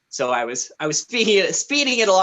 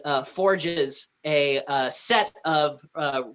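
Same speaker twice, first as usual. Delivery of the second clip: ordinary talk, nothing marked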